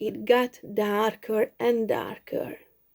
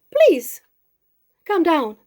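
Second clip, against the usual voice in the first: much higher and louder little plea; high pleading mouse; 5 semitones higher, 5 dB louder than usual. Acted. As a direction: beg the boy up the tree to come down. Mouse begs boy from tree.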